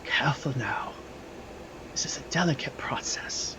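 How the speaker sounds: soft, whispery caution; hushed, barely voiced spirit; mysterious healer's voice. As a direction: whisper it as a careful healer at work. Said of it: "You hear a voice say".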